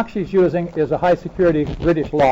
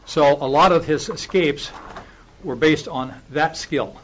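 normal talking voice

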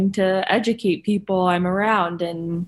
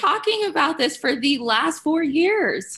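exasperated retort; 8 semitones higher than usual. Retold or imitated. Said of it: "I'm like".

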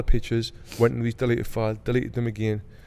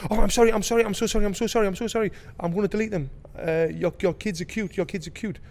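panicky apology; 9 semitones higher than usual. Retold or imitated. Man's panicked apology voiced.

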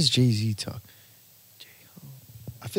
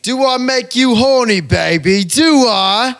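high, loud, slow Austin Powers; Austin Powers impression; 8 semitones higher, 8 dB louder, slower than usual. Friend answering a challenge with a loud, high Austin Powers. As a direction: ham up the cheeky British flirt, pitched high and loud. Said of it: "Austin Powers is a fucking legend".

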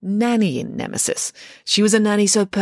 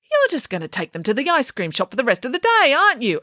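accusing; 7 semitones higher than usual. A narrator's reading. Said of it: "accused the headmaster".

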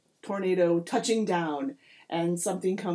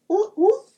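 high, loud little sea lion hoots; imitated animal yelp; sea lion call; 12 semitones higher, 10 dB louder than usual. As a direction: hoot twice like a tiny sea lion. Imitates tiny sea lion's call.

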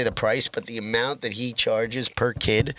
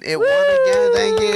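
real triumphant squeal, much higher and louder; excited cheer; 6 dB louder than usual. Real, not acted. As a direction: crow the win like a champion. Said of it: "self-celebrating win".

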